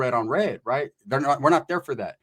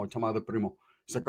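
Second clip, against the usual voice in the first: usual speaking voice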